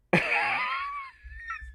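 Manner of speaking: very high squealing laugh; shrieking laugh. Real, not acted. Recalling the audience dying, a high squealing laugh.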